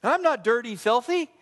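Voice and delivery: high indignant "hey, I'm not"; indignant protest. Retold or imitated. Acting out a listener denying he is a dirty sheep, high and indignant. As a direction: act out a listener who is offended. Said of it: "your first impulse is".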